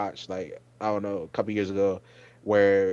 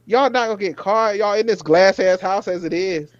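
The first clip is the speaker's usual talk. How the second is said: high, loudish ranting at Shane; exasperated rant; 8 semitones higher, 4 dB louder than usual. Retold or imitated. Ranting at Shane on screen, high exasperated rant. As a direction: lecture him in disbelief. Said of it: "I was like".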